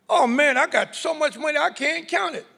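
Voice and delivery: high-pitched money brag; acted bragging. Acting the overwhelmed man with money.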